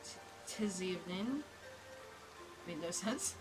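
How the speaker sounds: usual speaking voice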